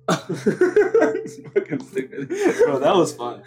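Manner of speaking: high, slow words through laughter; cracking up. Real, not acted. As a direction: laugh helplessly while talking. Both cracking up at themselves.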